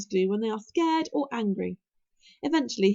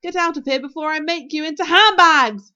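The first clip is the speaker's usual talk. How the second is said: loud shout building to the last word; yell; 5 semitones higher, 12 dB louder than usual. A narrator's reading. Stoic chasing out his hunting dragons, yelling. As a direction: yell the threat, biggest at the end. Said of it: "yelled Stoic".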